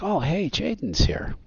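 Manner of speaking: high-pitched, delighted recognition; drawn-out, high hail from across the diner; excited greeting. Acted. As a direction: call it out like spotting a local celebrity. Townspeople greeting a local celebrity.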